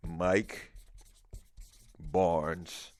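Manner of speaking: each word spaced by long pauses; emphatic slow proclamation. Shown as a demonstration. Host solemnly reading out a caller's award nomination. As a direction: announce it slowly, word by word, like a ceremony. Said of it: "make it emphatic".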